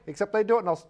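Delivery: ordinary talk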